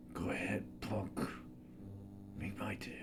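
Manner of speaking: Dirty Harry impression. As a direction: menace it out in a near-whisper. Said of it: "The next imitation I'd like to do is Dirty Harry".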